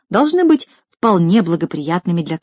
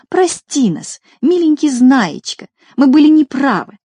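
imagined pleading apologisers; 8 semitones higher, 4 dB louder than usual. Pretend. Everyone comes to Знайка to apologise, imagined high pleading. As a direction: beg forgiveness in a high put-on voice. Imagined crowd begging forgiveness.

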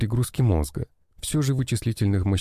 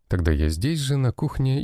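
plain reading voice

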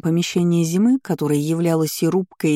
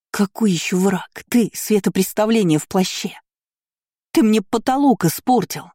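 furious snapping shout; angry bark; 4 semitones higher than usual. A narrator's reading. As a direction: bark it out in anger. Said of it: "рявкнула".

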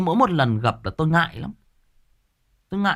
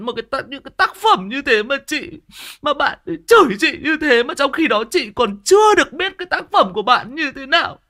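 sobbing editor impression; 12 semitones higher, 7 dB louder than usual. Mimicking the crying editor who felt insulted, high and tearful. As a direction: wail it like the offended editor crying. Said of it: "bà ấy khóc tu tu luôn".

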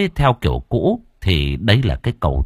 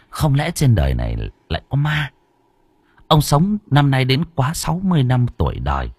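plain reading voice

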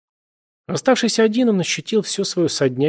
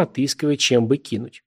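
usual speaking voice

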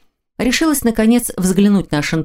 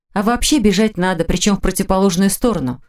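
ordinary delivery, nothing marked

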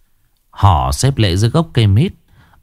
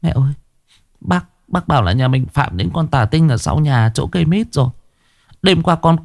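khóc thút thít; 7 semitones higher than usual. A narrator's reading. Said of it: "Cô khóc thút thít".

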